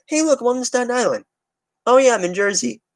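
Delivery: high, put-on voice of a clueless visitor; lightly mock a confused tourist at the ferry. Mimicking a clueless visitor to Staten Island, high mock voice. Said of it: "You're just like".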